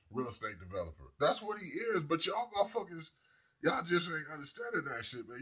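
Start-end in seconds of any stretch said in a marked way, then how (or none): none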